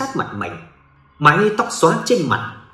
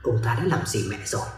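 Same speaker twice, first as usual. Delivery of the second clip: hushed inner voice, low and soft; inner thought; 5 semitones lower, 8 dB quieter than usual. Acted. Duy silently suspecting his father, hushed inner voice. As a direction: think it to yourself, barely voiced. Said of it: "trong đầu nghĩ".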